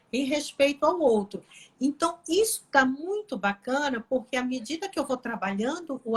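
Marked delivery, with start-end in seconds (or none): none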